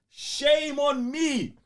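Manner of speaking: normal talking voice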